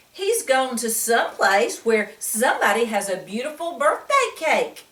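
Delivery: usual speaking voice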